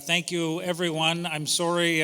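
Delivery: normal talking voice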